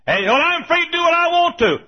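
high, loud mock defiance; mimicked indignant protest; defiant objector. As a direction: crow it like someone demanding their rights.

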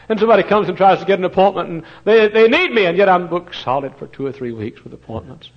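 loud, high, fast urgent preaching; fervent preaching. Acted. Preacher urging his congregation, loud and rising. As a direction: press the need on them.

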